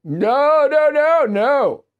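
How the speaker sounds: hammed-up refusal, building up; mocking a senator who won't budge; mock Schumer refusal.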